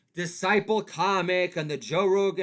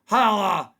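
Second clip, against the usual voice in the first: loud holla; 4 semitones higher, 10 dB louder than usual. Acted. Catchphrase shout.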